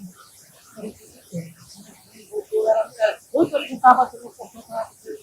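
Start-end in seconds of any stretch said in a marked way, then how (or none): 0.0-1.5 unvoiced retold whisper. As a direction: breathe it without voice. Repete a fala em sussurro. (sussurrando baixinho)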